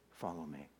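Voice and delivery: barely above a whisper, very soft and low; Jesus quietly inviting Peter back, tender and hushed; tender invitation. Acted. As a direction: offer it gently, like a quiet call home. Jesus looking Peter in eye.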